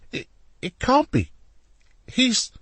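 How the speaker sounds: high, stammering disbelief; stunned disbelief. A narrator's reading. Leonardo stammers in shock at Alessandro. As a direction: voice jumps up in shock.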